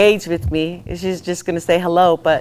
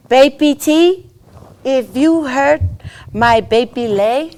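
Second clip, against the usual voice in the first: put-on slow, measured threat in a Tongan accent; imitated Tongan-accented sweet menace; Tongan mother-in-law; 4 semitones higher, slower than usual. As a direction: warn slowly with a Tongan lilt, rising at each pause. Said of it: "The Toki part came out in".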